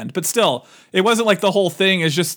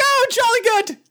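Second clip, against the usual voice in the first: high, hammed-up British toff; mock British accent; 10 semitones higher than usual. Imitating the possessed Jonesy, mock British. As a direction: put on a silly, high-pitched British accent. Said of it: "talking with a British accent".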